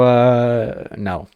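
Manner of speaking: ordinary talk, nothing marked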